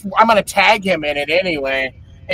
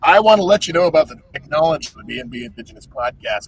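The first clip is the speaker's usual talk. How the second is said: fast, hammed-up infomercial pitch; mock infomercial; 2 semitones lower than usual. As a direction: sell it like a late-night ad. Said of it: "like a My Pillow commercial".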